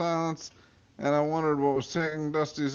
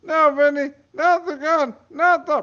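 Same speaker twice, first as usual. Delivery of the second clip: panicked pleading; 6 dB louder than usual. Acted. Bella begging in panic.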